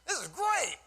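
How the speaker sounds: high-pitched, delighted exclamation; excited mentor. Retold or imitated. Quoting mentor's enthusiastic reaction.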